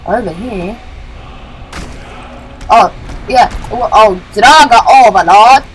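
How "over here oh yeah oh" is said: ordinary delivery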